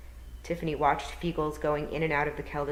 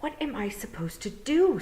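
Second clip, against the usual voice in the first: put-on higher, puzzled girl's voice; young girl Tiffany; 9 semitones higher than usual. Tiffany asking the toad, higher puzzled girl voice.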